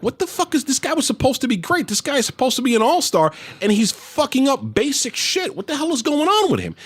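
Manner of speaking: high-pitched, exasperated retelling of a fan; mock outrage at a bungling player; indignant disbelief. Father's disbelief at failing player, acted.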